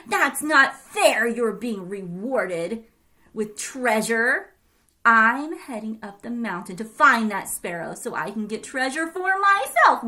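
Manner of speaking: seething greedy grandma; bitter and loud; jealous grumbling. Acted. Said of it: "seething with jealousy and rage".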